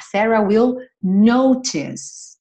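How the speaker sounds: Sarah will notice said slowly; slow model phrase. Shown as a demonstration. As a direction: stretch it slowly. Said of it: "slow model phrase".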